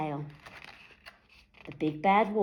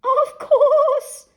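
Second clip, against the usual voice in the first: very high, loud, frightened squeak; startled Cinderella squeaking at the wolf; frightened stammer; 11 dB louder than usual. A narrator's reading. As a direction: stammer it out in terror. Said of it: "stammered a frightened Cinderella".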